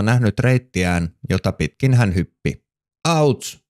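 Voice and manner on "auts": high yelp of pain; sudden ouch. Acted. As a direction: yelp as if stepping on a twig. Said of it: "kuului yhtäkkiä".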